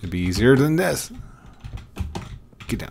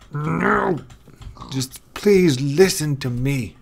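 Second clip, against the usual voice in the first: exasperated plea; 6 semitones higher, 4 dB louder, slower than usual. Real, not acted. Pleading with the pig.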